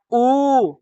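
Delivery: rounded उ; rounded vowel demo. Shown as a demonstration. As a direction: round the lips on उ. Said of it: "गोलाकार बन रहा है". (होंठ गोल करके उ)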